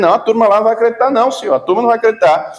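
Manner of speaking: rapid, high-pitched objection; protesting Moses. Retold or imitated. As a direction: blurt out a doubtful protest, very fast. Preacher voices Moses protesting to God.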